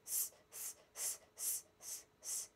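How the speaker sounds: soft unvoiced sss bursts; hissing s sound. Shown as a demonstration. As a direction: hiss the breath out in short bursts. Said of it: "we'll make the sound going s".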